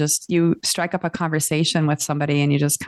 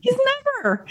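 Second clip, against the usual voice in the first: laughing disbelief. Real, not acted.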